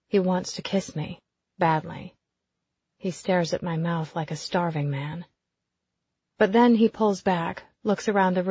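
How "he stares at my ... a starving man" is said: plain reading voice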